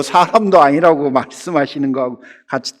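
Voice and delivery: usual speaking voice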